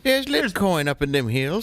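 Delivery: high hillbilly prospector voice; old prospector. Acted. Prospector sketch.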